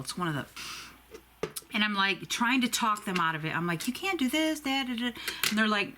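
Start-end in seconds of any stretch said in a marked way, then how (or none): 3.9-5.1 high, protesting plea; a patient protesting to staff in a much higher voice; pleading. Retold or imitated. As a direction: beg them not to transfer you.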